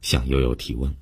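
usual speaking voice

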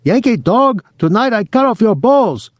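very high mock Japanese falsetto; hammed-up Japanese war-movie villain in falsetto; falsetto Japanese imitation. A narrator's reading. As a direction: threaten in a squealed fake Japanese accent. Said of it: "high falsetto in his Japanese imitation".

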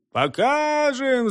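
high, drawn-out call; rallying cry. A narrator's reading. Lads answer the call to action, high drawn-out cry. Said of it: "закричали парубки".

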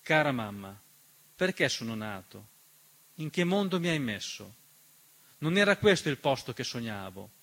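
ordinary talk, nothing marked

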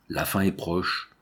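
slow, understated sinister pronouncement. A narrator's reading. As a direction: say it slowly, with quiet foreboding. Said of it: "d'une voix sinistre". (voix sinistre, lente et retenue)

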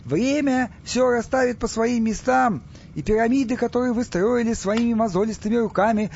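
high, ringing propaganda speech; resumed oration. A narrator's reading. Stas resumes his speech to Egyptians, high and declamatory. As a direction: declaim it grandly to a crowd. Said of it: "Стас возобновил речь".

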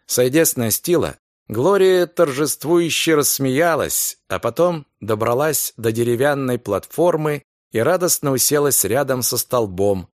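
usual speaking voice

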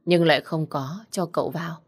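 ordinary delivery

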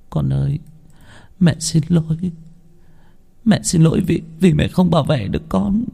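a mother ashamed, near tears; mother apologizing to her hurt son, softly grieving; tearful apology. Acted.

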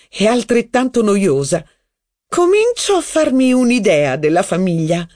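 a grande dame sneering at the family; witty duchess. A narrator's reading. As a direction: show off with teasing disdain. Duchess lecturing and mocking.

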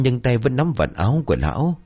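ordinary delivery

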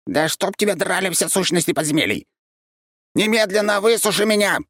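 high, shrill cursing; furious creature. A narrator's reading. Soaked creature curses the archmage, shrill and furious. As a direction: spit curses like a soaked cat.

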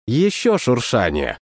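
plain reading voice

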